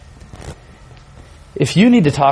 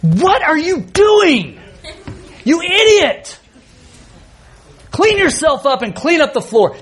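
much higher, slow hammed-up scolding; mock angry father; 11 semitones higher than usual. Father pretending to yell at his crying son, hammed-up anger. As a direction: snap at the child like a fed-up dad. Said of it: "I looked at him and I said".

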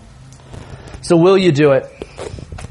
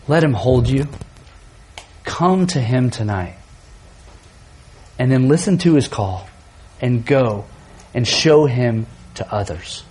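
gentle appeal; 5 semitones lower than usual. Real, not acted. Altar call, soft appeal.

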